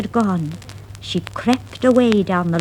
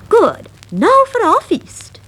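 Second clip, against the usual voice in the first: high, louder gleeful mouse; Lucy's voice; 13 semitones higher, 5 dB louder than usual. Acted. Lucy leading Miranda to the feast, high and gleeful. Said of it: "said Lucy".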